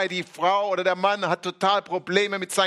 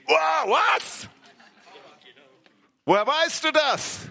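amazed woman; 8 semitones higher than usual. Retold or imitated. Woman amazed at Jesus' words.

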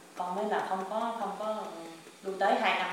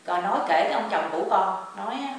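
normal talking voice

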